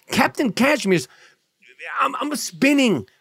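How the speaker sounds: high-pitched, hammed-up exasperation; comically upset. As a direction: let the pitch climb as if losing it. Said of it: "The Gringo Mandingo's upset".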